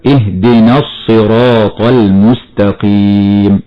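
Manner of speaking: slow, the alif of اهدنا dropped and linked into الصراط; careful Quran recitation; connected recitation. Shown as a demonstration. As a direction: run اهدنا into الصراط without the long a. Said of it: "هكذا".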